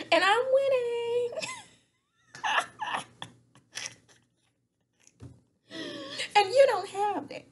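high squeals of laughter while quoting; can barely get the words out for laughing; cracking up mid-quote; laughing through it. Real, not acted.

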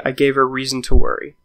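plain reading voice

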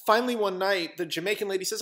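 ordinary delivery, nothing marked

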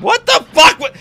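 loud, high, outraged yell; furious shout. Real, not acted. Streamer yelling at the game's character, furious shout. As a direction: blast it at the screen.

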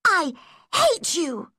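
put-on high-pitched furious girl; indignant schoolgirl outburst; angry cry. A narrator's reading. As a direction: fling it out with hatred, pitched high. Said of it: "she cried".

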